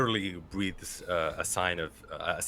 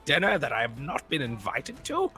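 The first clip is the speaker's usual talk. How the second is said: lightly higher, offended noble; a hint of indignant lord; 4 semitones higher than usual. Snubbed lord complaining of the dinner, mildly higher. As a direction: sound personally insulted.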